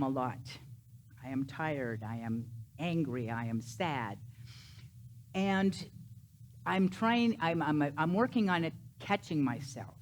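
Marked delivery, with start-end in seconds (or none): 1.2-4.2 lower, softer i am statements; mild sad. Shown as a demonstration. Preacher showing the self-talk, mildly downcast. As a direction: say them a little low and down. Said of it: "Instead of saying".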